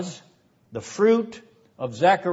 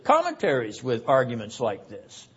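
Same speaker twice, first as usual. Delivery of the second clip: normal talking voice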